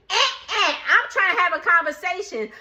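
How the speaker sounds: high, sharp mimicked shushing; scolding a child. Brooke silencing the stepdaddy, scolding. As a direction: imitate cutting an elder off like a toddler. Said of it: "talking to her child".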